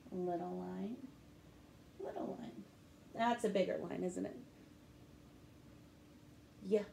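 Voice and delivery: quiet, gentle guided letter strokes; soft teaching voice. Shown as a demonstration. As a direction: coach little hands stroke by stroke. Soft letter-writing instruction.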